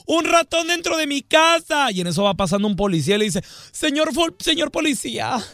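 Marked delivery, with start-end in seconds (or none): 0.0-1.9 high, hammed-up squealing cry for help; mock effeminate panic. Young man shrieking in the street about a mouse, high hammed-up panic. 3.7-5.4 high, hammed-up begging voice; mock effeminate pleading. Young man begging the policeman to help, high mock pleading.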